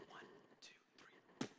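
hushed counting under the breath; mock whisper. Friends counting while lowering him.

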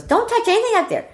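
high-pitched imitation of an angry dad; mock scolding, voice jumping up; mad father. Pack-rat father to daughters clearing attic, mad. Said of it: "He got mad about it".

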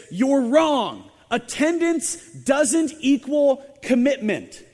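imitating an indignant mother's verdict; mock angry declaration. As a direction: hammer out the retort. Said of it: "she says".

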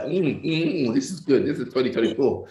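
hammed-up mmm of a tasting; savoring delicious food. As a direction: smack lips over a fine dish. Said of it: "they dipped out that Qatari pumps like".

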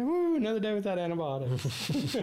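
high, hammed-up cheer of relief; mock celebration. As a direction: cheer it like a small victory. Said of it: "It's like".

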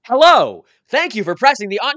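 bright, loud recorded-message voice; chirpy loud machine greeting; loud automated voice. Acted. OnStar recorded voice blares.

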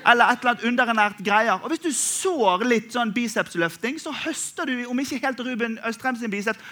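ordinary talk

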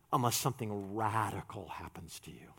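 hushed, breathy urgent warning; whispered warning. Acted. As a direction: lean in and whisper it.

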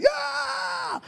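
high whooping yeah; a crowd cheering high; group shout of joy; excited cheer. Retold or imitated. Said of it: "everyone's like".

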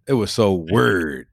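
Louisville accent, low and a little slow; moderately drawn-out Louisville accent. Shown as a demonstration. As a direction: say WEIRD in a Louisville accent with a shorter stretch. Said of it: "say it in my Louisville accent".

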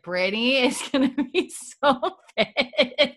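can barely get it out for laughing. Retold or imitated. Host laughing as she recalls her rewatch reaction.

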